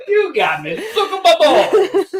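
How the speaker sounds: loud, high, drawn-out fake Italian; terrible Italian accent. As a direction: belt it out in a bad Italian accent. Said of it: "[name] does the terrible Italian accent".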